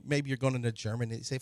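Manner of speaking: usual speaking voice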